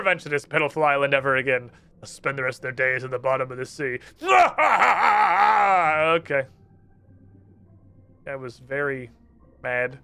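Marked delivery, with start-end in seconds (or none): 4.2-6.1 loud hammed-up evil cackle; evil laugh. Wizard gloats over his curse, evil cackle. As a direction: cackle like a triumphant villain.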